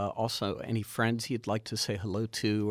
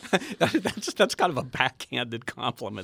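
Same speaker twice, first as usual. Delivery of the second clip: laughing; 7 semitones higher, 5 dB louder than usual. Real, not acted. Host laughs at odd compliment.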